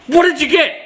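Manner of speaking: high, excited acted question; imitating an overexcited friend; eager customer. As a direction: burst out asking, dying to know. Customer eagerly asks about the present.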